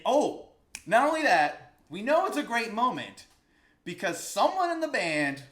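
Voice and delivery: can't hold back the excitement; thrilled. Real, not acted. Teacher gushing to viewers about a great moment, excited.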